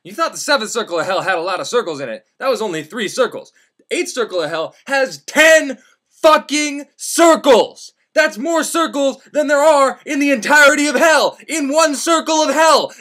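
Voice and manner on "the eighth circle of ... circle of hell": high, loud exasperated yelling, slowing down; outraged rant. Real, not acted. Ranting to the audience about hell's circles, loud outrage. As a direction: rail against the absurd numbers.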